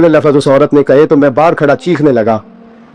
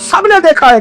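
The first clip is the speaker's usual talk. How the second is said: high, ringing sermon voice building up; impassioned preacher; preaching at full force; 8 semitones higher than usual. Acted. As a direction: preach it out, climbing with each line. Climactic retelling, rising preaching.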